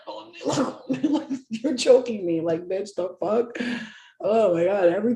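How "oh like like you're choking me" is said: laughing protest. Retold or imitated. Being pinned down, laughing.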